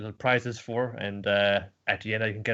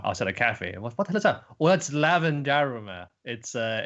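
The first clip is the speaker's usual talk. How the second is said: ordinary talk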